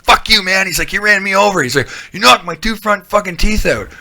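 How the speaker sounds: imitating the friend's squeaky fury after being run over; angry friend mock-yelled.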